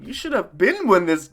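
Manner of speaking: normal talking voice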